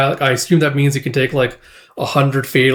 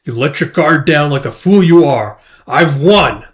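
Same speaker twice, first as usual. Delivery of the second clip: gloating villain; 2 semitones higher, 6 dB louder than usual. Acted. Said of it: "I was like".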